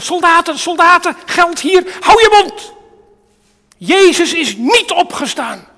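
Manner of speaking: loud, high, urgent commands to the soldiers; shouted bribe. Acted. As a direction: bark the orders like a panicked Pharisee. Pharisees bribing soldiers, acted out.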